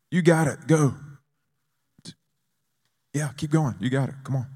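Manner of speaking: lightly acted pep talk; acted encouragement. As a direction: coax someone on with mock pep. God urging a nervous believer on.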